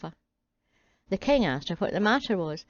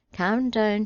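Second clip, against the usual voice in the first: ordinary delivery, nothing marked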